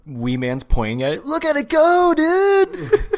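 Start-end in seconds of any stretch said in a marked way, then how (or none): 1.3-3.0 high, loud excited shout; mock amazed squeal. Imitating an amazed stuntman pointing, high excited yell. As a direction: squeal it in comic wonder.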